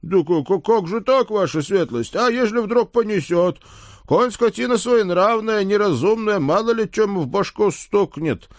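high, stammering peasant lad; frightened stable boy. Acted.

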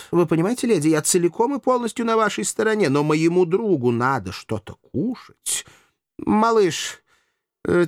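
hammed-up courteous warning; theatrical gallant master. A narrator's reading. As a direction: feign helpless concern for the ladies. Master plays up the threat.

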